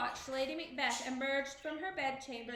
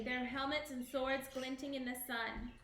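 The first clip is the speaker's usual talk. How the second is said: ordinary delivery, nothing marked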